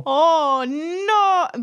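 loud, high wailed oh no; mock dismay. Co-host botched the catchphrase, mock wail. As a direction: wail it long.

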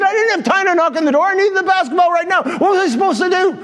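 high, hurried mock protest; defensive kid. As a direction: argue back like an aggrieved youngster. Kid argues back in acted quarrel.

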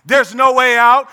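normal talking voice

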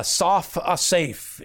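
"Soph Asaph" drawn out slowly, each syllable clear; Hebrew pun said slowly. Shown as a demonstration. Preacher showing the congregation the Hebrew wordplay, slow and deliberate. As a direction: pronounce "Soph Asaph" carefully, spelling out the sound. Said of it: "poetic puns throughout the book".

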